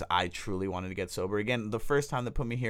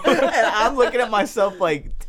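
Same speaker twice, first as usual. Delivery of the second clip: laughing; 12 semitones higher, 10 dB louder, slower than usual. Real, not acted. Laughing at the memory.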